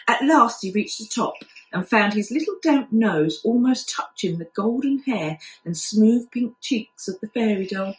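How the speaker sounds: ordinary delivery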